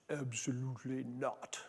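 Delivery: slow, imitated Austrian accent of an older man. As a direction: retell his words in a light Austrian accent. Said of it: "Peter had this delightful Austrian accent".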